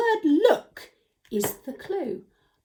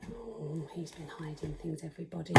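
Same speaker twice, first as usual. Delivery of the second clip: hushed aside; 7 semitones lower, 16 dB quieter than usual. Real, not acted. Confiding puppet's mischief.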